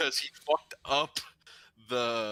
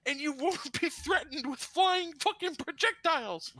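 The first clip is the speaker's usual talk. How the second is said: high-pitched, incredulous retold rant; exasperated urging; 12 semitones higher than usual. Applicant tells experienced manager to go elsewhere, exasperated. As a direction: push him in a high, exasperated voice.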